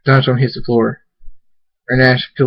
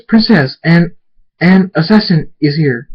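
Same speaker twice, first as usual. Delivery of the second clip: high, louder urgent report; raised, panicked guard voice; alarmed warning; 6 semitones higher, 5 dB louder than usual. Acted. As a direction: sound the alarm to your princess.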